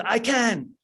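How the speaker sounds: imitating a high, final shout; a last mock cry of conviction; retold scream. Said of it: "he just finishes with".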